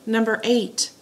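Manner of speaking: normal talking voice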